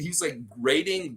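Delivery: ordinary talk, nothing marked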